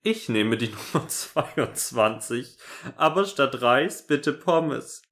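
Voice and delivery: giggling through the order; laughing. Real, not acted. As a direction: read it while cracking up. Laughing while reading.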